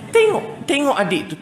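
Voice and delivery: high, sharp pretend order; mock parental scolding. Parent sending the eldest to watch the sibling, mock scolding. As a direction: order the child about with a sudden high burst.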